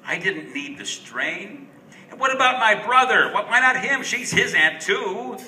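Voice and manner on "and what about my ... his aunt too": much higher and louder, fast and peevish; whining excuse, pushing the blame onto his brother; indignant protest. Acted. Narrator grumbling to himself about his brother, higher and louder.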